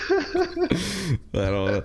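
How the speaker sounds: high, slow laughing words. Real, not acted.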